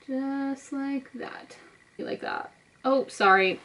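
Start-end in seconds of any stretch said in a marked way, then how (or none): 0.1-1.6 slow, soft, absorbed; drawn out under the breath; slow drawn-out murmur. Real, not acted. Absorbed mumbling while applying makeup. 2.0-2.5 breathy whisper to herself; soft whispered aside. Real, not acted. Absorbed mumbling while applying makeup.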